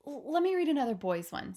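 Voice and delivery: a touch of eager pleading; eager request. Acted. Sophie pleading for another label.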